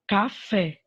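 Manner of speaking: "café" sounded out with a strong final "fé"; teacher's demo of "café", weight on "fé"; stress on FÉ. Shown as a demonstration. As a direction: punch the last syllable of "café". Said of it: "Então, fé é a última sílaba".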